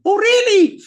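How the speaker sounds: very high, loud "Oh, really?"; surprised Parvati. Acted. Parvati hearing Shiva got prasadam, high and surprised. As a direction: leap up in delighted surprise.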